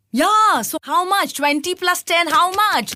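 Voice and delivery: high, loud, insistent questioning; impatient pushing. Retold or imitated. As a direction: press him hard for the answer. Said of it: "I'm becoming angry".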